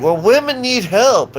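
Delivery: high, loud mocking voice on "Well, women need help"; hammed-up mock plea; mocking whine. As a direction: parody the other side in a high, loud, whiny voice. Mocking the opposing view.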